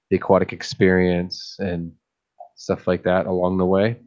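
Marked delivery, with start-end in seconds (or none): none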